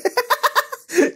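high cracking burst of laughter. Real, not acted. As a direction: break into a squeaky laugh. Laughs at absurd age range.

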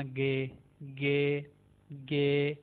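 "गे" drawn out very slowly. Shown as a demonstration. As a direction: stretch each "गे" slowly. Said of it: "इसको जी नहीं बोलना". (बहुत धीरे "गे")

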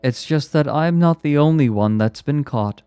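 high, loud, strained irony; semi-hysterical. A narrator's reading. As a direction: let it out high, near hysteria. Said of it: "high-pitched and semi-hysterical".

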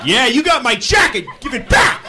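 loud, high shouting in bursts; mock furious demand. Demanding a jacket from the startled driver, mock furious shout. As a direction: act out unhinged indignant yelling. Keeps up the absurd argument.

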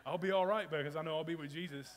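loud and sure, almost shouting; loud declaration. Real, not acted. Preacher to congregation on not fearing missiles, loud declaration. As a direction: proclaim it with full conviction.

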